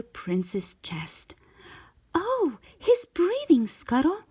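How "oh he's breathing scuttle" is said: much higher, joyful exclamation; lightly voiced happy cry; relieved cry. A narrator's reading. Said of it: "she cried".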